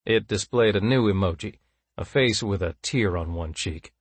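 plain reading voice

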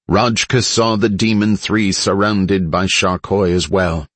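ordinary delivery

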